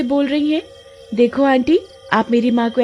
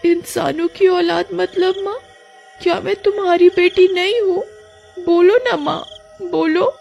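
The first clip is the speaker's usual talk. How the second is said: put-on much higher, desperate girl; pleading daughter; 9 semitones higher than usual. Roopa begging her mother to say she is her daughter. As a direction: beg her mother for the truth.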